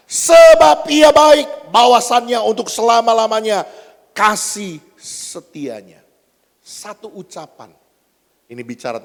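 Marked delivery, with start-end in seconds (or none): 0.1-6.0 shouted praise. Acted. Preacher voicing the crowd's shared praise to God, shouted. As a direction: cry it out like a whole crowd praising, easing off at the end. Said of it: "mereka berteriak dengan ucapan yang sama".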